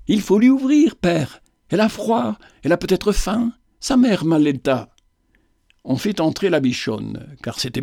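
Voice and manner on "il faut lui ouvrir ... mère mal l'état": put-on high, urgent child's plea, easing off; pleading girl. Little cousin begging her father to open the door, high and urgent. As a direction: beg father to let her in.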